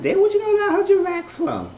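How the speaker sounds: high, shocked disbelief; shocked high-pitched question; incredulous squeal. Real, not acted. Shocked question about money.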